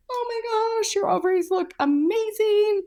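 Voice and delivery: high, gushing mock delight; a high thrilled imitation of the nurses; imitating gushing staff. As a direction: coo over the scan.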